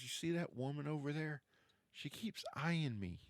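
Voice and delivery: a mild hush, as if not to be overheard; hushed aside. Acted. Dahlia warns the player about the watching woman, softly. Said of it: "she goes".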